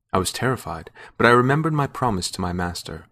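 ordinary delivery, nothing marked

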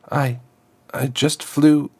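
put-on high, halting stammer of a scared girl. Daughter stammers an excuse to her stern father, nervous and halting. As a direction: stumble over the first word, frightened. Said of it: "she stammered".

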